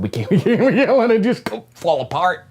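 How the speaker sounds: high, loud laughing panic as the Fed; laughing mock panic. Imitating the Fed's panic, laughing. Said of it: "They're probably thinking".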